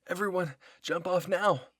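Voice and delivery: loud, high shouted order; yelled command. Acted. Someone orders everyone to jump off, yelling. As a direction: yell an urgent order to everyone. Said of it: "I heard someone yell".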